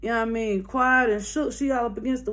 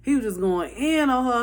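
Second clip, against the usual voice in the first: usual speaking voice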